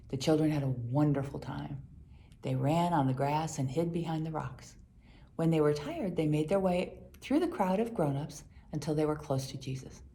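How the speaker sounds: plain reading voice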